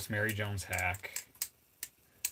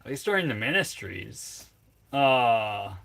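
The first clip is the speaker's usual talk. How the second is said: loud, higher, defensive retort to chat; loud indignant rant at chat; indignant comeback; 3 semitones higher, 10 dB louder than usual. Real, not acted. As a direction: fire back at the insult, loud and high.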